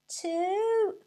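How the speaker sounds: high, loud taunting call; crowd calling out. A narrator's reading. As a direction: sing it out cheekily. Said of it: "called the crowd".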